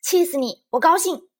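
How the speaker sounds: high, loud spiteful jeer; taunting shout. A narrator's reading. Lizi taunts her departing friend, shouting. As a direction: yell it to provoke. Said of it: "丽兹冲她喊".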